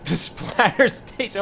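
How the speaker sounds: slow, laughing repeat of the name. Real, not acted. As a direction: chuckle it out.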